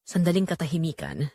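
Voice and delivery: usual speaking voice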